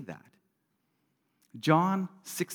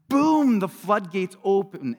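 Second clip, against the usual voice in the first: sudden burst; 5 semitones higher, 7 dB louder than usual. Acted. Floodgates of emotion opening.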